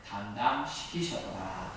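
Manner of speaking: solemn, measured Bible reading; scripture reading. Acted. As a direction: read it out like a pulpit lesson.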